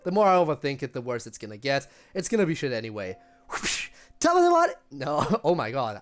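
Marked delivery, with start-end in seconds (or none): 4.2-4.8 squeaky high mock Irish voice, loud; a bad try at an Irish lilt; attempted Irish impression. Chat asked for an Irish greeting, so a shaky high Irish voice. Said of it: "I need to go higher".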